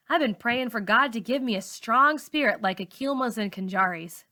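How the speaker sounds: plain reading voice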